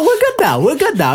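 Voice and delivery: high, giggling reassurance; laughing. Real, not acted. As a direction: laugh it off while insisting you're fine. Laughing off his rant.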